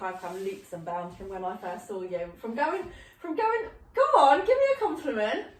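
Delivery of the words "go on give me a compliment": laughing tease. Retold or imitated.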